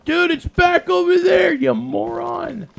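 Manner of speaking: loud frustrated yell at a gamer; yelling at the screen. Retold or imitated. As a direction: shout it like a viewer yelling at the screen. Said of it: "you guys are screaming at the screen going".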